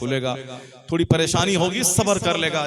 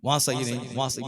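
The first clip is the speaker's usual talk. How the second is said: quick repeated refusals in a lowered voice; negative relatives; 4 semitones lower, 5 dB quieter, faster than usual. Retold or imitated. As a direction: mildly imitate dismissive relatives, lower. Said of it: "माने सब negative".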